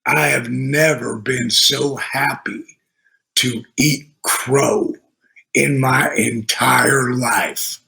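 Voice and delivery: put-on low, slow gravelly-free older wrestler voice; Michael P S Hayes. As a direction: drop low and savor each word slowly. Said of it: "He said".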